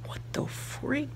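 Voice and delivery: slow, high whispered disbelief; hushed what-the-freak; whispered shock. Retold or imitated. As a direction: breathe it out, stunned. Repeated reaction, now whispered.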